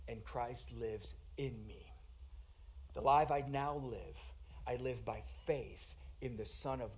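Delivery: low, soft, reverent scripture quote; very soft, low and unhurried; hushed recitation. Acted. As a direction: recite it quietly, as a personal confession. Preacher reverently recites Paul's verse.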